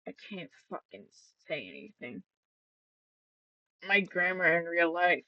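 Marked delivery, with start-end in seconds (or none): 0.1-2.2 frustrated mutter. Real, not acted. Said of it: "Oh my god".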